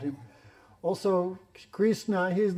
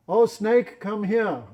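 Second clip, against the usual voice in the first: raised, louder call to the snake; calling as Vidyavali; 5 semitones higher, 6 dB louder, much slower than usual. Acted. Krishna, as Vidyavali, calling out to the snake, loud. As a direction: summon the snake loudly in her voice. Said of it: "doing his voice as Vidyavali".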